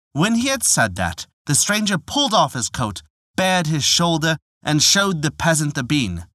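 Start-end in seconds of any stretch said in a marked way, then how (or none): none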